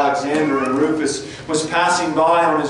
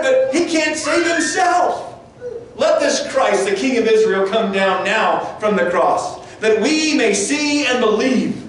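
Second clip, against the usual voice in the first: mocking shout; 8 semitones higher than usual. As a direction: shout the taunt up at him. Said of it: "Can you imagine the mocking".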